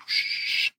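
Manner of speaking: drawn-out hushing noise; imitated hissing shh. Soldier recalling what appeared in the woods, imitated hiss.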